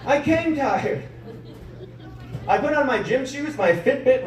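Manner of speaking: high-pitched, incredulous comeback; indignant protest. Acted. Worn-out beginner answering a gym coach, indignant protest. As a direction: protest in a high, put-upon voice.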